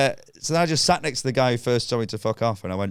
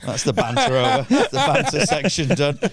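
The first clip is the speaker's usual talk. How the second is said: higher, laughing sign-off of the banter; laughing through words; 4 semitones higher, 4 dB louder than usual. Retold or imitated. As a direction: chuckle it out, amused and defeated. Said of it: "And I went".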